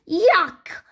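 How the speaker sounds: high disgusted yuck. A narrator's reading. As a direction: spit it out in disgust. Landing in tar.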